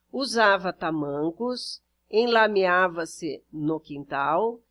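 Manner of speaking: ordinary delivery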